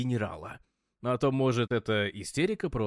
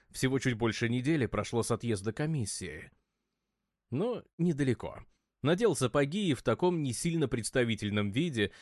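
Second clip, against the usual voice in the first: plain reading voice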